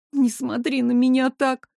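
ordinary delivery

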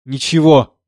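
high, loud raging outcry; furious shout. Acted. Composer screaming at death, furious roar. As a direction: rage at death with full voice. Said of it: "закричал с яростью".

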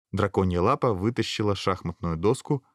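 ordinary delivery, nothing marked

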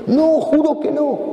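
high, slow, desperate swearing; desperate oath. Acted. Peter swearing he doesn't know Jesus, high and desperate. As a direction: swear it in panic.

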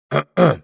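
polite loud ahem; throat clearing. Acted. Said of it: "Саймон откашлялся".

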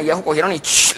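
usual speaking voice

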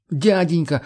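put-on high, pleading child-like wail; wailing plea. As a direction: wail for help like a scared kid. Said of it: "заголосил он". (жалобно заголосил)

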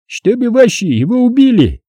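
high-pitched, lightly mocking question; wry question. A narrator's reading. Vakha questions the narrator's advice, lightly wry. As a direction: ask with a touch of wry scorn, pitched high. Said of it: "криво усмехнувшись, спросил Ваха".

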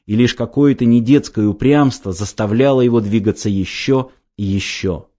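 plain reading voice

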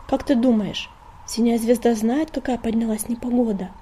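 usual speaking voice

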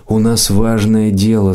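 plain reading voice

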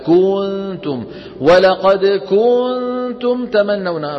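كنتم with a long held, chanted u; over-stretched vowel. Retold or imitated. Teacher imitates a reciter's mistake, over-stretched vowel. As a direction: drag the first vowel of كنتم out far too long. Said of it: "بيقول لك".

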